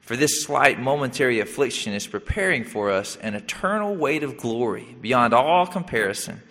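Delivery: ordinary talk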